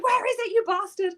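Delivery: high, indignant demand; mock angry squeal. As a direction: squeak it out like a furious text. Angry message to hiding friend.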